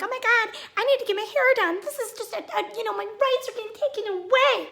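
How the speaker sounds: whiny mocking quote. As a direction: mimic them whining about a haircut. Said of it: "they're acting like".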